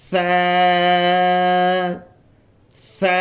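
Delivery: "سا" as soft th, tongue at the teeth; soft, long letter. Shown as a demonstration. Teacher showing tongue on teeth, soft and drawn-out. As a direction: hold the vowel of "سا" on one steady note. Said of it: "زبان نکال کے تھوڑا سا دانتوں کے ساتھ".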